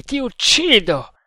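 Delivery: high, loud death threat; enraged yell. Acted. Tremal Naik threatens to kill his prisoner, yelling. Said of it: "gli urlò negli orecchi".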